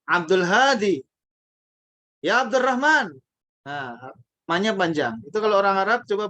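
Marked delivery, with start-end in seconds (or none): 0.1-3.2 Hadi and Rahman with long held a, louder; long vowel. Shown as a demonstration. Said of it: "hanya dibaca panjang".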